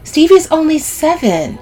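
high, loud, slowed protest; scandalized objection; shocked exclamation. A narrator's reading. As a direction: defend the child, appalled. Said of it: "Sylvie exclaims".

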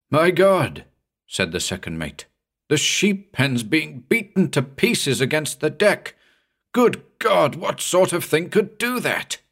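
aghast at what smashed the pen; shocked alarm. A narrator's reading. Second mate aghast at the smashed sheep pen, alarmed.

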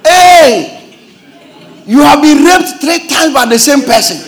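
incredulous outburst. Acted. Pastor rebukes a churchgoer's story, loud disbelief. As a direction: call out the absurdity.

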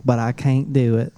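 mock Southern apology, drawn out at the end; Southern accent. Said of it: "That's your Southern accent".

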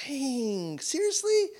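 seriously shoots up high in pitch; a hammed-up stunned "seriously?"; mock disbelief. Pastor mock-stunned at God's flat no, pitch jumping up.

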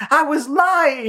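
very high cartoon rabbit; high offhand confession; Bugs voice. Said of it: "He goes".

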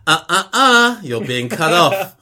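high, loud teasing reprimand; sing-song telling-off on a voicemail; scolding voice clip. Acted. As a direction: tut out the stops, then deliver the verdict. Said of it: "the voice clip that goes".

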